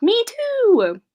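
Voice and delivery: ordinary delivery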